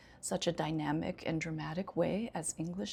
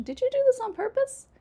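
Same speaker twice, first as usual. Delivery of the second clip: quick, very high, loud polite question; 8 dB louder, faster than usual. Shown as a demonstration. Teacher swapping a scolding for a question, mock-polite high voice. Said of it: "I will ask a polite question".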